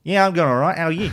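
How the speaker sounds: lightly put-on Ocker greeting; a playful touch of broad Ocker; Ocker accent. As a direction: toss back an Ocker Aussie hello. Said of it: "Were you going Ocker?".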